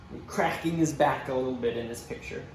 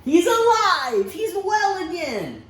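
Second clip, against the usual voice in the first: much higher, loud, slow joyful cry; joyful news; 14 semitones higher, 6 dB louder, slower than usual. Acted. Servants telling the father his son lives, overjoyed. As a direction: burst out with the good news. Servants announce the boy is healed.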